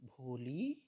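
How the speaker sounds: low, loving call of भोली with a rising end; teacher calling a frightened girl tenderly; tender call of a name. Acted. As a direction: say भोली gently, like a kind teacher. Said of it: "बहुत प्यार से कहती है".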